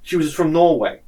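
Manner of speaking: ordinary talk, nothing marked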